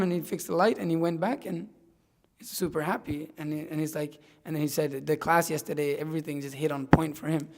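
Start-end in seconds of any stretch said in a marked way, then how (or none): none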